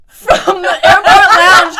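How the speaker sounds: loud, high, drawn-out laughing shout; excited yell. Real, not acted. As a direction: shout it out in gleeful disbelief. Hyping the friend's surprise reappearance.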